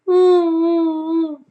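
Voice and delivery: high, muffled whimpering hums; muffled crying hum. Acted. Boy inside the pot, whimpering muffled cries. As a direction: cry with the mouth closed.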